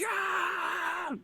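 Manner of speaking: long, high strained mock scream; imitated scream. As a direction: let out a drawn-out mad yell. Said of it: "he's screaming around".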